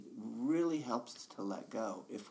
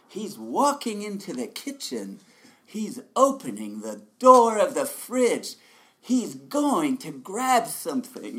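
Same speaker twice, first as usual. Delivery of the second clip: higher, louder play-by-play of everyday moves, slowing at the end; inner sports commentator; 8 semitones higher, 15 dB louder than usual. Retold or imitated. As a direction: call every tiny move like a live Olympic broadcast. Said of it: "the inner commentator".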